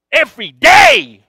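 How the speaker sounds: high, drawn-out yell; exasperated shout. Real, not acted. Preacher stressing how often the coworker nagged him, exasperated shout. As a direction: cry it out very loud, stretching the words. Recounting the daily nagging, exclaims.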